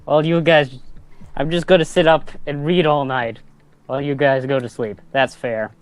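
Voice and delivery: ordinary talk, nothing marked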